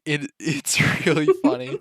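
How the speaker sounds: amused, breaking into laughter; laughing. Real, not acted. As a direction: talk through real giggles. Cracking up at co-player's voice.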